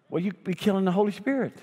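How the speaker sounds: light mock of an earnest churchgoer; hint of a worried lady. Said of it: "A lady came to me one time and said".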